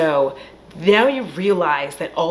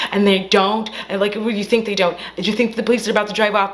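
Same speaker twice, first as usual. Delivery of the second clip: ordinary talk, nothing marked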